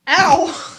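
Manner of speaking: loud, high "ow!"; sudden yelp. Real, not acted. Host cut off mid-explanation, yelping ow. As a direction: cry out sharply mid-sentence.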